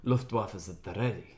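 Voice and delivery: low, soft gruff German accent; low, quiet mock German. Shown as a demonstration. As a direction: clip it like a German officer. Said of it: "my German accent is very good".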